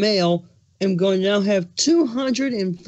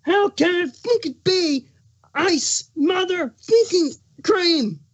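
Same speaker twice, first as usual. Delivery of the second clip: furious rage; 8 semitones higher than usual. Acted. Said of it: "his plastered with anger".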